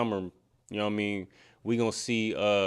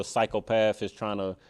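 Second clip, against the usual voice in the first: ordinary talk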